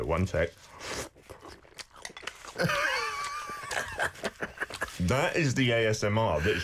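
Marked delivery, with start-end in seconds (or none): 2.0-4.9 big soggy crunch into food; loud crunch. Shown as a demonstration. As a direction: crunch loudly for the mic. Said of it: "here we go".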